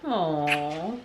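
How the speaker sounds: usual speaking voice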